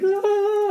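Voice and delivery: long high-pitched fake crying wail; imitated little-boy bawl, held high; mock kid's wail. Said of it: "Dana's, like, crying".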